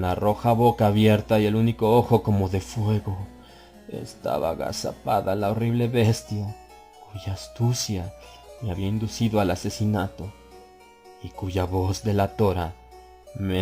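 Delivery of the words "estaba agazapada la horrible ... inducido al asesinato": ordinary delivery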